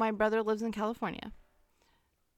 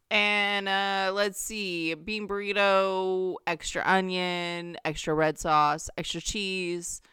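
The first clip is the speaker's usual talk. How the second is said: slow, drawn-out list of add-ons; mock-pondering drive-thru customer; deliberately dawdling order; 2 semitones lower, slower than usual. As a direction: take your time stretching each item. Dragging out a padded order.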